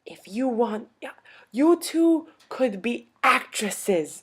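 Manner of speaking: high, slow, emphatic old-lady imitation; old European lady. Old lady flattering two pianists, high and drawn out. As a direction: proclaim it slowly as the little old lady. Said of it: "and then she was like".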